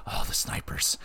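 hushed and breathless; awed hush before the snipers; reverent whisper. Real, not acted. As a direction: whisper it like a sacred topic. Introduces beloved sniper guns.

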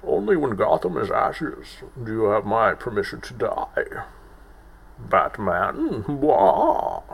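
a light impression of a masked villain; lightly voiced masked villain; Bane voice. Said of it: "that reminds me the most of Bane".

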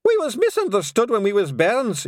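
high, loud Scots plea; frantic Scots pixie yelling; desperate yell. Acted. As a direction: beg the lads to back you. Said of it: "yelled Rob Anybody".

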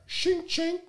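very high metallic "Shing, ching" vocal effect; shotgun rack noise. Retold or imitated. Imitating the gun racked behind the door, a high metallic noise. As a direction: mimic a shotgun being racked. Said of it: "I hear that motherfucker rack".

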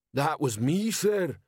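wounded troll complaint, pitch climbing at the end; reproachful. A narrator's reading. Troll reproaches his commander, reproachfully. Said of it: "said the troll reproachfully".

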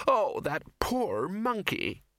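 high, slow, drawn-out lament; pitying young woman. A narrator's reading. Ginger watching the captured librarian, high slow pity. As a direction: wail in pity for the ape.

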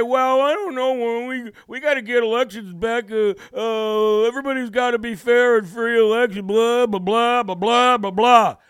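mocking a senator who cries about fair elections, building to loud blah blah; mock whiny politician. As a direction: whine it like a sniveling politician. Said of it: "to sit there and cry and say".

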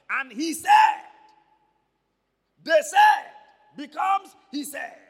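"said" shouted high and loud; preacher hammering the word "said"; stress on SAID. Shown as a demonstration. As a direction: punch "said" hard at the end of each phrase. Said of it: "Did you notice that?".

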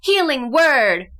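spell incantation. Pretend. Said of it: "You have to say it that way".

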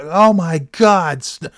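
high, slow mock outrage; mock yell. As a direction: start the shocked monitor's shriek. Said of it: "She's like, yelling".

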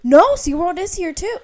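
loud, high, excited correction; real excited near-scream; excited outburst. Real, not acted. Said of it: "screaming. I get excited".

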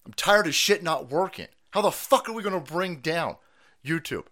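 usual speaking voice

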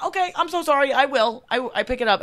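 high, loud, flustered compliance; hammed-up apology. She obeys the cop, hammed-up apologetic rush. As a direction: over-eagerly apologise to an officer.

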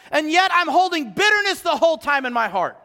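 rising sermon voice, pressing the point; impassioned preacher pressing the congregation; preaching. Acted. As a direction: preach it with building force. Preaching at full force.

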